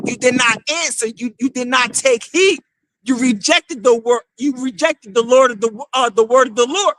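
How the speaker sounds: usual speaking voice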